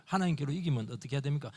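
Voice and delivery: usual speaking voice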